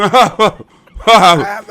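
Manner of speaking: blurts it out loud and high; a burst of loud sound as he starts; loud sudden blurt; sudden loud outburst. Real, not acted. [name] bursts loud mid-answer.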